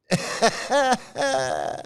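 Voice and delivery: a short, high burst of laughter; amused laugh. Real, not acted. Mocking a scammer, a short high laugh. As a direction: laugh at your own jab.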